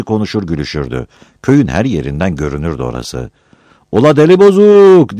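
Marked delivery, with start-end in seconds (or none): 3.9-5.2 high, loud village jeer across the square; shouted taunt. Acted. Villager on the roof jeers at Battal, shouting high and loud. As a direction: yell it from the rooftop. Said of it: "diye bağırdı birisi".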